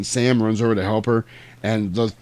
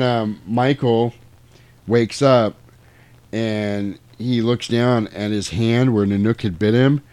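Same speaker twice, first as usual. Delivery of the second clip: normal talking voice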